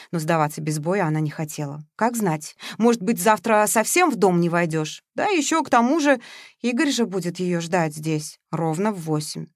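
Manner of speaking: ordinary delivery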